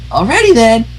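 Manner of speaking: high, bouncy cartoon pony voice; high, giddy pony chatter; bubbly Pinkie Pie. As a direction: chirp it like an overjoyed pink pony. Pinkie chirpy.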